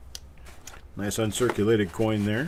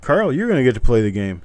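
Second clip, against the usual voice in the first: loud announcement; 2 semitones higher, 10 dB louder than usual. Real, not acted. Host announcing Carl gets to play.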